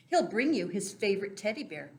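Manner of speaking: ordinary talk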